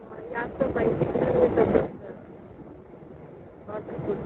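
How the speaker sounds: faint, half-whispered over a bad line; very soft, breaking-up voice; barely audible. Real, not acted. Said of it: "Ma'am, your voice is very low".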